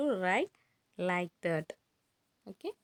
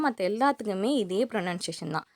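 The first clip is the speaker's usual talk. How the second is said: ordinary delivery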